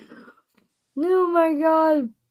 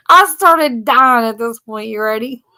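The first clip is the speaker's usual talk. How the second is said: normal talking voice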